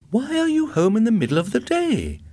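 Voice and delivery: high-pitched accusing question. A narrator's reading. Wife confronts husband home in the middle of the day, accusing. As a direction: demand an explanation, voice pitched right up. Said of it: "she asked accusingly".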